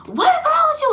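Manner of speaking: very high, loud mock shriek; mom freaking out. Mom walking past her crying child, high freaked-out cry. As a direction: imitate a panicked mother screeching the question. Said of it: "she just started freaking out".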